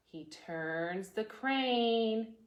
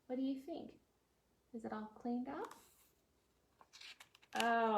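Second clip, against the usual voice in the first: usual speaking voice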